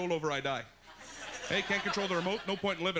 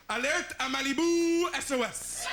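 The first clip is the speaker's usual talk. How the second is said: high, slow, loud French announcement; French title; 7 semitones higher, 4 dB louder than usual. Shown as a demonstration. Announcing the French Baywatch title, slow and grand. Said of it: "In French".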